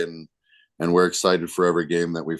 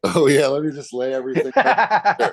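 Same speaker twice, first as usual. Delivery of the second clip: high laughing sarcasm; sarcastic laugh; 9 semitones higher, 4 dB louder than usual. Real, not acted. Sarcastic reply to asking his plan.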